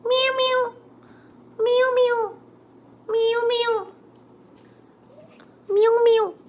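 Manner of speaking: high mock cat meows; meowing. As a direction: meow like a kitten to the cat.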